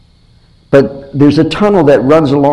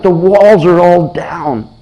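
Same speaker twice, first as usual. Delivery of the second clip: anguished Nehemiah; 5 semitones higher, slower than usual. Retold or imitated. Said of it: "He was like".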